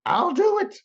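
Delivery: mock high-pitched squeal of surrender; pleading surrender. Man in tears giving in to God, pleading surrender. Retells his tearful, pained surrender.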